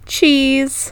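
usual speaking voice

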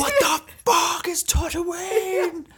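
slow, high mock scream; outraged Star Wars fans. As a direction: drag it out in a hammed-up fan tantrum. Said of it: "Star Wars fans are like".

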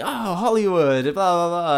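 high, drawn-out jeer; slow sing-song mockery, sliding down; mocking taunt. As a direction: imitate bullies teasing with a nickname. Voicing bullies jeering a nickname.

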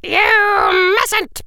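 high, squeaky little voice; squeaked. Acted. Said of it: "squeaked Professor Flitwick".

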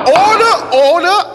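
high, firm call for order; judge calling order. Acted. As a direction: bang the gavel and shout order. Judge calls court to order.

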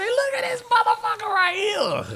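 very high, drawn-out whoop of amazement; excited fan yell. Retold or imitated. Fan reacting to LeBron's shot, excited yell. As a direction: stretch it out in a high, awestruck holler. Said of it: "when LeBron hit that shot the other day, I say".

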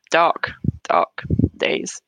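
slow, whispered and heavy; real grief. Real, not acted. Grieving mother recalling the first weeks, slow and whispered. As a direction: let the words almost fail you.

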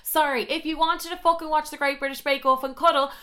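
high, indignant retold rant; heated comic tirade, hitting key words hard. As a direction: tell him off with mounting outrage. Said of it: "Oh, we're getting heated".

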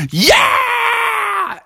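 very high shouted yeah; victory yell. Acted. Bubby cheers as his band wins, high yell. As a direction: whoop like you just won.

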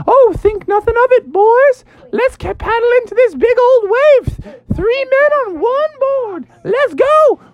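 very high falsetto surfer hero; jolly high-pitched bravado; Daniel Tiger. Acted. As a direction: whoop it up like a cartoon surfer dude.

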